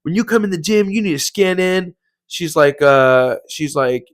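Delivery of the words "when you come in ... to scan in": Karen. Retold or imitated. Gym employee scolding a member, mock yell. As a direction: imitate an upset lady telling someone off. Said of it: "This lady screams".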